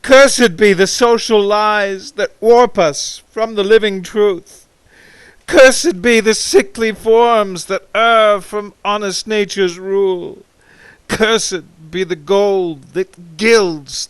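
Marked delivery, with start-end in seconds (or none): 0.0-10.4 louder, higher railing curses; loud indignant cursing; bitter curse. Acted. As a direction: hurl each curse against society. Recited curses on society.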